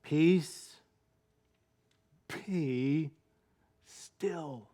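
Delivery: soft words fading to nothing; preacher echoing Jesus to the sea; hushed command. Shown as a demonstration. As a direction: breathe it out gently. Repeating the calming words.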